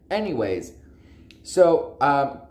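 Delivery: ordinary talk, nothing marked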